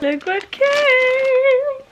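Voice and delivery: high, drawn-out thrilled squeal; excited. Real, not acted. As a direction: squeal it out with delight. Parcel arrives, thrilled.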